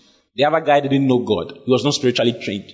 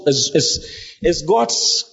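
ordinary talk, nothing marked

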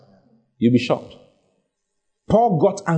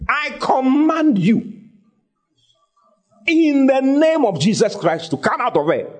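high, slow, forceful command; commanding rebuke; 10 semitones higher, slower than usual. Retold or imitated. Paul ordering the spirit out of the girl, high forceful command. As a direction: drive the spirit out with authority.

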